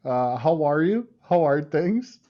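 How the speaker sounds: overly cheerful cold-call greeting; telemarketer voice. Acted. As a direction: put on the eager phone salesman. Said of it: "That's the telemarketer voice".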